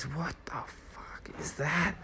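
shocked unvoiced whisper. Naked friend noticing the smell, in a stunned whisper. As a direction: hiss it out in disbelief.

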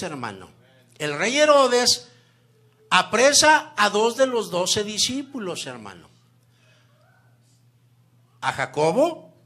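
loud, driving sermon voice; full-force preaching. Real, not acted. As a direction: preach it hard to the congregation.

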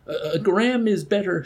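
high-pitched panicky stammer; frightened stammer. A narrator's reading. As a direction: stumble into it, pleading. Woman stammering while shaken.

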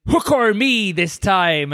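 slow, drawn-out pirate growl. Shown as a demonstration. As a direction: say the title like a pirate. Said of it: "I keep doing it in a pirate voice".

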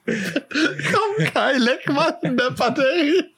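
high, squealing laughter through the words; helpless high-pitched giggling speech; laughing hard. Real, not acted. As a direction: barely get the dare out for laughing. Cracks up at battery-licking dare.

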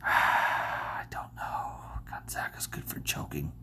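slow, sighing whisper to oneself; whispered doubt. Real, not acted. Bracket picker unsure about Gonzaga, whispering. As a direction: breathe it out in a whisper. Hesitating over a hard pick.

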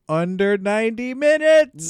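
big announcer finish on minutes; emphatic. Acted. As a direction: land it loud like a show title. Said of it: "I said the second part".

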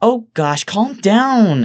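very high, loud woman's reproach; exasperated Evelyn. Acted. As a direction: scold him in a high voice. Evelyn reacts to his outburst.

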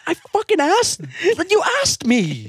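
mock the parent who asked; insolent. Acting out parent argument.

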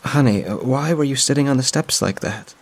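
a lightly voiced older woman, gently worried; concerned mother's friend. Friend finds her on the steps, lightly voiced concern.

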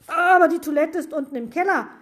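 high, fast, loud whine; wailing complaint. A narrator's reading. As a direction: whine in a rush. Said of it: "jammerte Max". (hoch und hastig gejammert)

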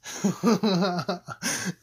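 loud burst of laughing; real laughter. Real, not acted. Comedian laughing at his own punchline. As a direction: crack up at the joke.

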